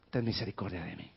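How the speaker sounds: drops low and soft, pleading; a hushed, humble plea for mercy; quiet plea. Acted. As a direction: beggar softly begging for mercy. Quote's closing plea after shouting.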